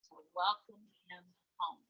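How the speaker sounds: plain reading voice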